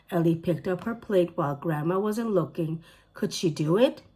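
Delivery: ordinary delivery, nothing marked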